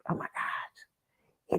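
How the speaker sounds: slow hushed whisper; awed whisper. Real, not acted. Explaining the mark of the beast, whispering in awe. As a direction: whisper it in dread.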